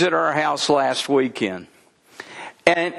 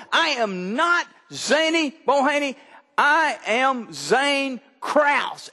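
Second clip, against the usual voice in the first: put-on higher, very slow stubborn little boy; imitating a grandson's firm protest; toddler insisting; 7 semitones higher than usual. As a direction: spell it out like an indignant toddler. Grandson insisting on his name.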